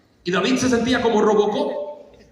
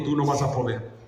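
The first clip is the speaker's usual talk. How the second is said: lower, softer falling put-down; dismissive doubt; 7 semitones lower, 5 dB quieter than usual. Acted. King Saul tells David he will fail, dismissive. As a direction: rule it out under the breath.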